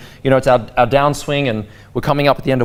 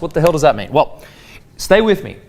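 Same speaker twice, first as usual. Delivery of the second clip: ordinary talk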